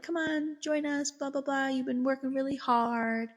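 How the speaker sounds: high, lilting imitation of coaxing hosts; coaxing invitation. Family urges the therapist to stay for dinner, coaxing. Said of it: "try to get me to, like, stay for dinner".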